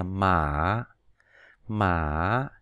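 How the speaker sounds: "mã" dipping down then up; slow dipping third tone "mã"; Mandarin third tone. Shown as a demonstration. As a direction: drop "mã" low, then lift it. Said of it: "đi xuống xong rồi lại đi lên".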